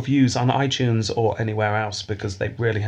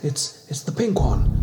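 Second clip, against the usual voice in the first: whispering; 4 semitones higher than usual. Acted. Tense bomb defusal whisper.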